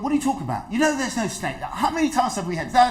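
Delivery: high, laughing disbelief; exasperated daughter. Retold or imitated. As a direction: scoff at her mother's fears with a laugh. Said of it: "She went".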